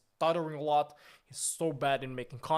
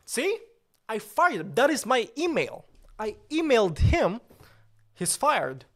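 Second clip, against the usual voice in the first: loud, high indignant yelling; angry shouting; 6 semitones higher, 9 dB louder than usual. Acted. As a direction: yell it as proof, outraged. Shouting proof at chat.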